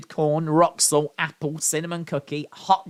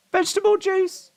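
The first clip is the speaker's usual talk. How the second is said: very high, breathless wheezing squeal; wheezy voice. Real, not acted. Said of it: "I can't get my breath".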